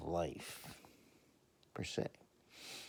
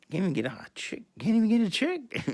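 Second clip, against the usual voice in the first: mock despair; 12 semitones higher, 8 dB louder than usual. Voicing patient's despair, rising.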